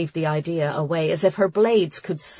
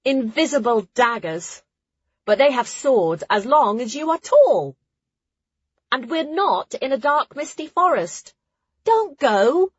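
put-on higher, animated girl's dialogue voice; urgent warning character; 7 semitones higher, 4 dB louder than usual. A narrator's reading. Companion warning the others not to go, urgent. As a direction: plead with them, pitched up.

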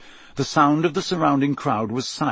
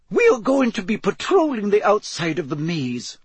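high-pitched teacher briefing her charges; brief them in a raised, formal voice; professor instructing; 8 semitones higher than usual. Acted. Professor briefs the champions before the maze, high instructing voice.

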